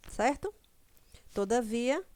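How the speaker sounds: usual speaking voice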